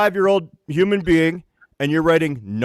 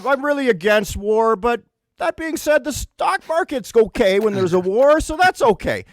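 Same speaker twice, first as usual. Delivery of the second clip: mock pompous speaker, much higher; mocking pundit; 6 semitones higher than usual. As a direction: parody a smug statement. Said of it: "when people say".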